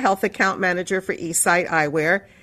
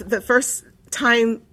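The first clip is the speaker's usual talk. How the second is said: usual speaking voice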